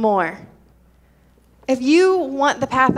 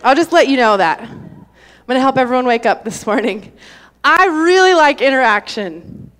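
usual speaking voice

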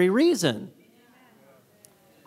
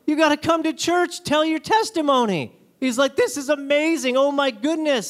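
higher, eager, gushing; eager invite; 7 semitones higher than usual. Retold or imitated. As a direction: rush to invite him.

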